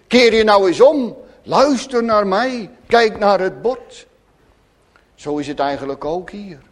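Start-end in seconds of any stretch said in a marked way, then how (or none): 0.1-1.2 loud, stern teacher telling a chatty pupil to turn around. Retold or imitated. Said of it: "Dan zegt de juffrouw eigenlijk precies hetzelfde". 1.4-4.1 loud, firm orders to a pupil; stern teacher. Retold or imitated. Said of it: "Dan zegt de juffrouw".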